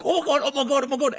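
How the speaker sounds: high, loud, laughing panic; panicked kids. Retold or imitated. Kids panicking after the spit hits the man. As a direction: shriek it like kids caught out. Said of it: "we're all like".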